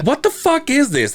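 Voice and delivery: high mock uncle voice; high-pitched uncle holding up the brochure. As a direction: sneer it like the teasing uncle.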